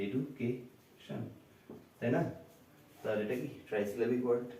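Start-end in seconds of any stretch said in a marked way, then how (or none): 0.1-1.2 এডুকেশন slowly in three parts, softer; split into syllables. Shown as a demonstration. Teacher saying it three ways, এডুকেশন slowly broken into pieces. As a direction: spell out এডু, কে, শন with gaps. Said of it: "তিন ভাবে উচ্চারণ করতে হয়".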